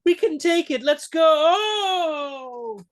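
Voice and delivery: high, loud, greedy squeal; shrill greedy reach, hammed up; mock Elsa. As Elsa grabbing for the Grail, high hammed-up squeal. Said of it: "what does she fucking do".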